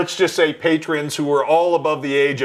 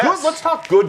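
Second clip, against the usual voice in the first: excited; 12 semitones higher than usual. Real, not acted. Announcing the topic.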